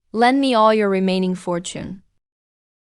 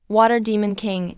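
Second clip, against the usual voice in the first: usual speaking voice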